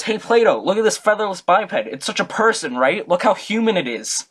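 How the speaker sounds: high, loud taunting call; mocking Diogenes. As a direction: wave the plucked chicken and jeer. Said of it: "he goes".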